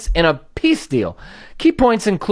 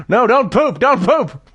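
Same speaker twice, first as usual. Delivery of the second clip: higher-pitched pleading with a puppy; mock panic; 5 semitones higher than usual. Owner holding puppy up to the ceiling, mock panic. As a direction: plead urgently, in comic alarm.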